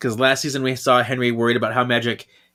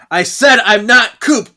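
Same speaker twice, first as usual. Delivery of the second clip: slow, much higher and louder reveal; dramatic mock yell; 10 semitones higher, 10 dB louder than usual. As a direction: declare it like a villain unmasked. Possessed Coop reveals himself.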